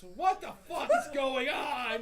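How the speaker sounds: loud, slow, laughing yell; shouting. Acted. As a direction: yell it up at the sky. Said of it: "just shouts".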